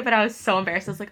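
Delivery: ordinary talk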